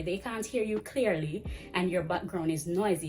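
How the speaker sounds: ordinary talk, nothing marked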